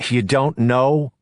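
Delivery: can't believe what he hears; alarmed. A narrator's reading. Ellis alarmed that Nancy is unsure, slow disbelief.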